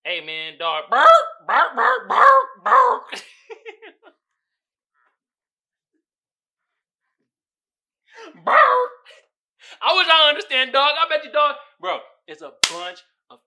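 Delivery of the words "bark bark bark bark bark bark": loud high yelping barks; dog barking. Pretend. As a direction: bark like a small dog.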